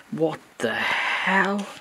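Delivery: What the hell stretched out very slowly; drawn-out disbelief. Real, not acted. Seeing a creepy doll.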